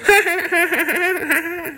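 high mischievous cackle; high impish cackle; leprechaun cackle. Acted. Leprechaun laughs and runs off.

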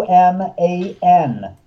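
M A N spelled slowly, each letter apart; slow clear spelling of M A N. Shown as a demonstration. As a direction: spell out M A N one letter at a time. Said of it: "That's how you spell my name".

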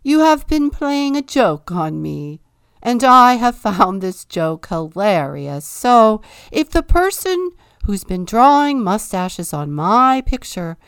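loud, high, falsely cheerful address; king luring the culprit with mock friendliness; false jovial proclamation. A narrator's reading. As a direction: fake good humour to trap the culprit. King tricking his subjects.

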